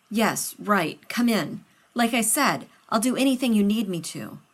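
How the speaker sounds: a nervous friend rushing to help; loud and hurried, anxious to please; Rodney, flustered. Acted. Rodney voiced by narrator.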